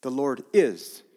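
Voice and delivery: The Lord IS, slow with the weight on "is"; slow, firm emphasis on "is"; stress on is. Shown as a demonstration. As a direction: lean on "is" as present tense. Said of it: "Not was or will be".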